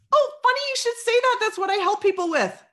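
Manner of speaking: high, loud and gushing; squealing delighted salesperson; gushing excitement. Shown as a demonstration. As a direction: gush like a thrilled seller who found a buyer. Excited seller acted out.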